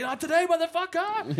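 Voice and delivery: high, loud hammed-up catchphrase; over-the-top mock battle cry; cheesy video guy. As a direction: imitate a cheesy homemade action hero. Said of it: "the most cheesiest".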